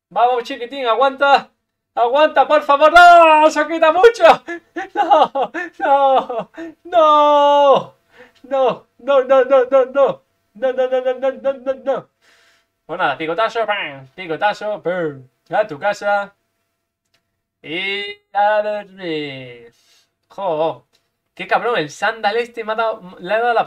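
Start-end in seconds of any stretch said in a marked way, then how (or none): 0.1-2.9 higher, louder begging; nervous cheering plea; anxious pleading. Real, not acted. Pleading for his creature. 2.9-12.1 high loud repeated no; frantic cry of loss; despairing shout. Real, not acted. Losing the fight. 17.6-19.7 very slow drawled taunt; mock lullaby; gloating goodnight. Real, not acted. Finishing blow.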